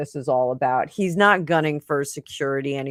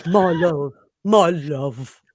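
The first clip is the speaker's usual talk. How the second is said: corny romantic voice; 4 dB louder, much slower than usual. Retold or imitated. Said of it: "That is so corny".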